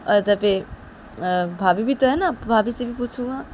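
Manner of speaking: lightly voiced stubborn boy; wheedling brother. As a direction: argue to go in person. Rahul arguing back.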